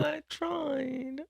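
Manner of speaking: soft submissive voice. Retold or imitated. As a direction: say it small and defeated. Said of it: "You sound soft and submissive".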